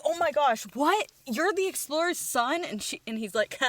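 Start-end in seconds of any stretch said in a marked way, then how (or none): 0.0-2.6 high-pitched astonished fan; amazed disbelief. Retold or imitated. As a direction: gasp it out, pitched well up. Quest learns who he is.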